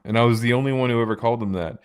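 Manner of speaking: normal talking voice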